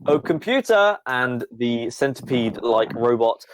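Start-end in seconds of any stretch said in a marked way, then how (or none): 0.1-1.0 much higher, bright summoning call; Crackle calling. Acted. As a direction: call out to the computer, delighted. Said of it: "and goes".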